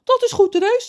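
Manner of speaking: high, loud, chirpy agreement; cheerful. A narrator's reading. John agrees to lend the bus, loud and cheerful. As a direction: agree brightly at full voice. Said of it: "zegt John vrolijk".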